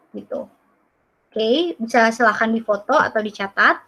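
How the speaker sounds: ordinary talk, nothing marked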